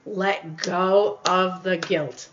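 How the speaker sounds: drawn out slowly and let fall; slow repeated line to a guilty viewer, let it settle; slow mantra, falling. Shown as a demonstration. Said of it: "repeated slow mantra".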